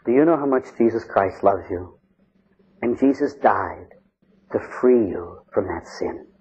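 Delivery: low, soft and unhurried; gentle priestly comfort, hushed and deliberate; tender reassurance. Retold or imitated. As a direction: console a frightened penitent, slowing toward the end. Priest consoles a shaken penitent.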